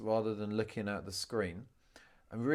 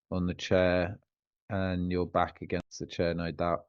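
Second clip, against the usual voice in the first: lower, relaxed meditation guide with long pauses; guided meditation; 4 semitones lower, 6 dB louder than usual. Real, not acted. Coach leading a client through a grounding meditation, guided meditation voice. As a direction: guide them down into the body, leaving room to feel.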